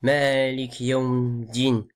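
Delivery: "Malik Yaoum Din" with no final vowels; faulty "Malik Yaoum Din"; dropped endings. Shown as a demonstration. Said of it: "On dit pas".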